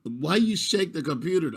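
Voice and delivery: ordinary talk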